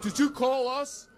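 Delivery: gung-ho cop. Retold or imitated. Cops arrive at his house, gung-ho cop imitation. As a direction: imitate a beefy cop taking charge. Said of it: "some beefy [name] type of cop".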